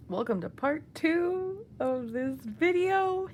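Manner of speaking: ordinary talk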